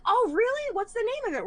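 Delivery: light up at the idea of a treat; eager mom. Retold or imitated. Mom asking her daughters about the rewards program, excitedly. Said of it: "And she's like".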